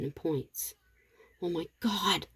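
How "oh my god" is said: hushed, softer gasp of alarm; soft exclamation. A narrator's reading. Abigail seeing the doorknob move, softly exclaiming. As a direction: exclaim it under your breath. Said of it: "Abigail softly exclaims".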